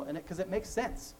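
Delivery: usual speaking voice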